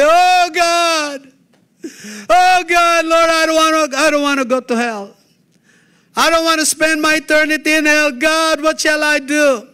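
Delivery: high, loud, desperate pleading, voice near breaking; crying out to God. Pretend. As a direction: beg God in panic, as if facing hell. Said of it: "You're gonna cry unto God and say".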